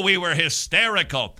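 normal talking voice

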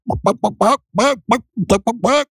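high-pitched amateur chicken clucks; chicken clucking. Shown as a demonstration. Host playfully talking to a chicken, clucking imitation. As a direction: do a silly falsetto hen, cluck by cluck. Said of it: "That's my chicken impression".

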